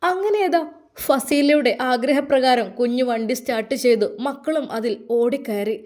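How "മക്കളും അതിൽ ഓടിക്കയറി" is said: ordinary delivery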